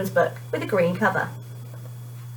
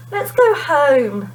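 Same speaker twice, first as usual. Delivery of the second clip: slow, weary sigh; tired and fed up; 5 semitones higher, 3 dB louder, much slower than usual. A narrator's reading. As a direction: drag it out, fed up. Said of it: "bored, tired, and fed up".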